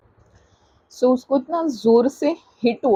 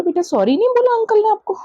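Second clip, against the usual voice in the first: indignant question; 8 semitones higher, faster than usual. Retold or imitated. Mother asks daughter again.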